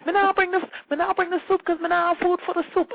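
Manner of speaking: high, loud patois imitation of the delivery man; Jamaican patois. Delivery man explaining the missing soup, loud patois imitation. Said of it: "he's yelling something".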